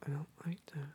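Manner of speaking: unvoiced whisper. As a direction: breathe it out, no voice. Answers the whisper in kind.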